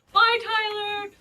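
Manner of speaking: high, loud sing-song farewell call; calling goodbye. Retold or imitated. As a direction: wave goodbye across the room with your voice.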